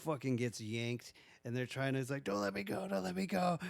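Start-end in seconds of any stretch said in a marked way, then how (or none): 2.2-3.6 high-pitched mock pleading, not shouted; desperate plea. Man being dragged down by the rope, desperate plea. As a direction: imitate frantic begging to be held.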